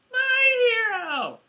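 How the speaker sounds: slow, very high swoon; squeaky mock damsel, drawn out; melodrama damsel. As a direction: swoon over the rescuer in falsetto. Damsel thanks her rescuer.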